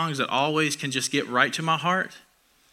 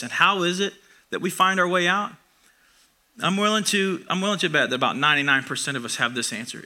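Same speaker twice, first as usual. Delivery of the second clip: usual speaking voice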